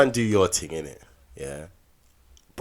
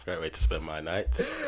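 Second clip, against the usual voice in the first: ordinary delivery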